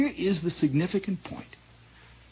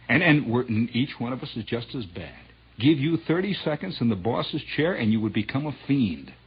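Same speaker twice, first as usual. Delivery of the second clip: ordinary talk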